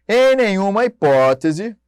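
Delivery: each word drawn out loud and slow; emphatic slow reading. Shown as a demonstration. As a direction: read "Em nenhuma hipótese" slowly and heavily. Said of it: "emphatic legal reading".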